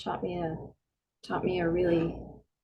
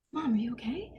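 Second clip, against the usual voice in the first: acted gentle concern; 3 semitones higher, 8 dB quieter than usual. Daughter phoning upset mom, gently concerned. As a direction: check on her softly. Daughter checking on crying mother.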